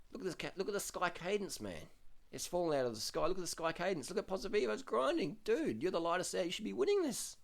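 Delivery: exasperated. Real, not acted. Exasperated at the climber's cadence.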